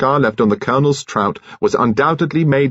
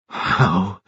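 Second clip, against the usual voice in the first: stammering. A narrator's reading. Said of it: "she stammered".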